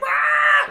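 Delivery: very high, loud retold yell; mock scream. Customer losing it at the pawn shop, very high, loud yell. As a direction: let out his crazed yell.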